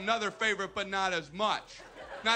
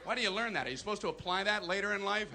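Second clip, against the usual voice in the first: normal talking voice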